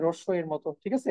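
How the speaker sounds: usual speaking voice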